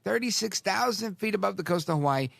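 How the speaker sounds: ordinary delivery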